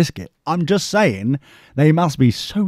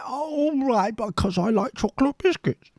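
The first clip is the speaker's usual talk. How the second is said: high, soft, resigned agreement; hammed-up meek surrender; mock meek giving-in; 8 semitones higher, 8 dB quieter than usual. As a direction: give in sheepishly for the treat. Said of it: "And I'm like".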